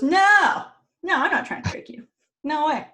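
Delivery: high-pitched mock protest, loud first no; over-innocent denial. Storyteller as Odin denying a trick, over-innocent.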